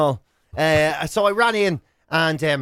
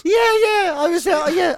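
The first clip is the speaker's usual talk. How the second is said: normal talking voice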